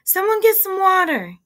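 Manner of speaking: loud, high-pitched frantic shout; frantic order. A narrator's reading. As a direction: shout for help in a panic. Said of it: "she ordered frantically".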